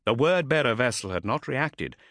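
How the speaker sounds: plain reading voice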